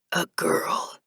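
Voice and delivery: slow, strained, whispered monotone; ancient reaper whisper. A narrator's reading. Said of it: "monotone voice".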